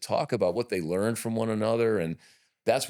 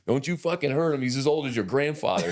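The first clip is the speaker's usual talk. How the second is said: buddy warning; 8 semitones higher than usual. Retold or imitated. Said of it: "Dave's always like".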